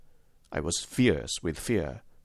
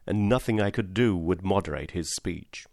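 usual speaking voice

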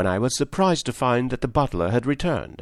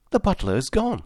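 strange maid; 8 semitones higher than usual. A narrator's reading. Maid's strange hysterical state.

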